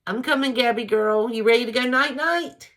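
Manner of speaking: high, loud sing-song to the dog; pet talk. Real, not acted. Owner calling her little dog Gabby to bed, high and loud. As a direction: coo and call up to your pet.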